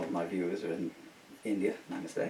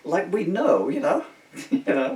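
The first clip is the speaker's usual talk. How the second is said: ordinary talk, nothing marked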